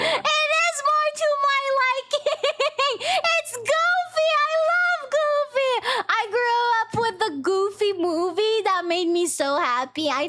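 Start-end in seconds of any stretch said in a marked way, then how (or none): 0.0-5.7 high, giggling and delighted; giggly delight. Real, not acted. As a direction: laugh through it with joy. Delighted by Goofy sound.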